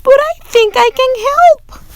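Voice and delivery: put-on sweet old woman offering help, high and quick. A narrator's reading. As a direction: bubble it out eagerly like a kind granny.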